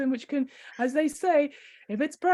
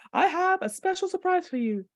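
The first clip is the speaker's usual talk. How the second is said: ordinary delivery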